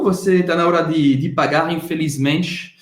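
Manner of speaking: usual speaking voice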